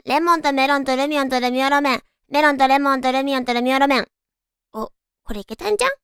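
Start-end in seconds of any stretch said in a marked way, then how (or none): 0.0-4.1 tongue twister at full speed; fast tongue twister. Shown as a demonstration. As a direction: rattle it off quickly. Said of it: "早口言葉にチャレンジ".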